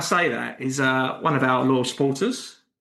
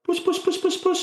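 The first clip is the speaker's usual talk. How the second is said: very high, quick repeated cat call; high-pitched pussycat summons. Shown as a demonstration. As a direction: coax the cat over in a tiny high voice. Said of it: "put your hand and you go".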